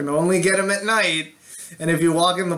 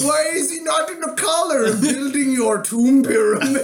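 higher, hammed-up vampire through laughter; mock vampire; 6 semitones higher than usual. Vampire confronting friends in the park, lightly voiced mock villain. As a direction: lightly play a cartoon vampire, nearly cracking up. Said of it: "this vampire just shows up and is like".

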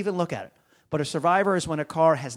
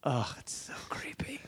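whisper; 2 semitones lower, 12 dB quieter than usual. Real, not acted. Reacting to creepy impression.

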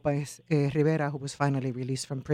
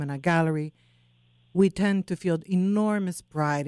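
ordinary delivery

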